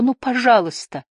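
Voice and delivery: silently begging someone to hurry; pleading. A narrator's reading. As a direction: plead with them, voice lifted. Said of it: "мысленно умолял Лив".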